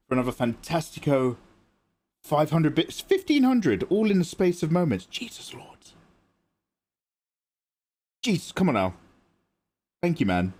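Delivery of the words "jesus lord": breathless whispered awe. Real, not acted. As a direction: whisper it in disbelief. Unvoiced whisper.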